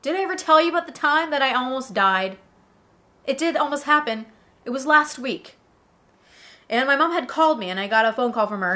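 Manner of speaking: normal talking voice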